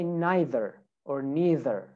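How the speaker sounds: American "neither" two ways, "nye-ther" then "nee-ther", R sounded; American accent. Shown as a demonstration. Teacher models American "neither" both ways, final R sounded. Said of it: "in America".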